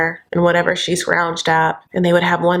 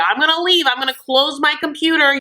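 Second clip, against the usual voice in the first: mock huffy David; 8 semitones higher than usual. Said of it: "getting mad".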